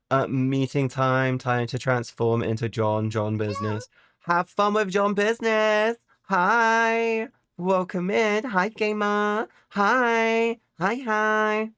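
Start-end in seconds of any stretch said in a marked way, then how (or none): 4.2-11.7 high chirpy hellos; sing-song high greeting; high cutesy greetings. Real, not acted. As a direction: greet viewers in a bright high voice. Greeting viewers in cute voice.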